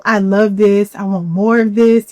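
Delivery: ordinary talk, nothing marked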